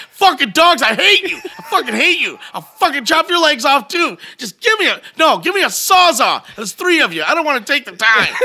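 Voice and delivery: high, loud furious tirade at the dogs; angry rant at the dogs. Retold or imitated. Cleaning the kitchen, yelling at the dogs, furious. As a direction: rage at the dogs while laughing through it. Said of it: "I'm like".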